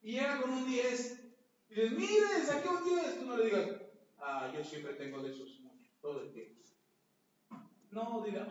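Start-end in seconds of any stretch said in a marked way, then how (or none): 1.9-3.2 high, loud, bursting with pride over a grade; proud excited child. Retold or imitated. Child running to a sibling with a ten, excited. 4.2-6.6 dismissive sibling. Retold or imitated. Sibling belittling a brother's ten, dismissive.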